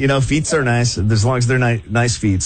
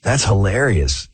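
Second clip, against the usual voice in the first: ordinary talk, nothing marked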